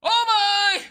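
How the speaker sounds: mocking shout. Taunting streamer.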